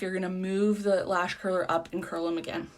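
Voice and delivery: normal talking voice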